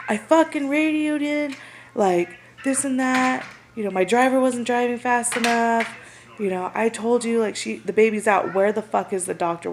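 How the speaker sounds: high, heated mock rant; angry rant. Paramedic berating the ER staff over the missing doctor, heated. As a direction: vent hotly at the staff.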